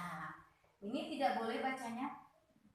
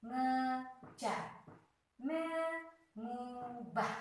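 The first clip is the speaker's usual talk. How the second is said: slow review read; 4 semitones higher, much slower than usual. Shown as a demonstration. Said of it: "ulangan kata contoh".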